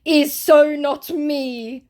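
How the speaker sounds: high, loud, drawn-out whine; hammed-up lamenting protest; mock self-pity. Scammer protests his innocence, mock lament. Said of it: "self-pitying, lamenting scammer".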